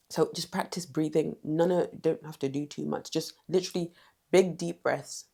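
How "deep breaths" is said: normal talking voice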